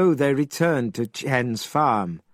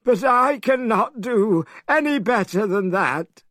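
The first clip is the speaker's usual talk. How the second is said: Pigsy complaining; 9 semitones higher than usual. A narrator's reading. Pigsy sulking to Monkey after a failed change, high and whiny. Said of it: "complained Pigsy".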